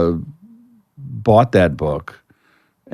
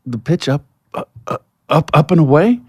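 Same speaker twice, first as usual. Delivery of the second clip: higher, halting, unsure guess; nervous hesitant answer; 8 semitones higher than usual. Retold or imitated. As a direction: stammer it out like a nervous young player. Nervous kid answering Ted Williams.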